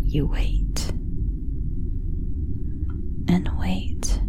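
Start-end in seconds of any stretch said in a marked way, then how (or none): none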